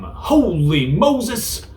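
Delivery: high, slow drawn-out gasp of wonder; hammed-up holy moses; mock amazement. Shown as a demonstration. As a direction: stretch it out in exaggerated awe. Example exclamation for yabe.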